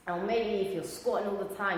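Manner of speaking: usual speaking voice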